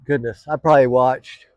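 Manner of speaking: talking through a yawn; stretched out mid-yawn. Real, not acted. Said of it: "by the way, I'm yawning".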